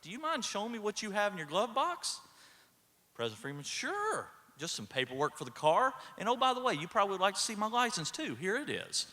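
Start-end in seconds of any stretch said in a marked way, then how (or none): 0.0-2.2 high-pitched, tentative polite question; imitating a baffled officer, pitched up; a little puzzled. Senior cop asking to see the glove box, puzzled. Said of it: "sitting there a little puzzled". 3.6-9.1 imitating someone all too happy to oblige; breezy compliance. President handing papers to senior cop, breezily innocent.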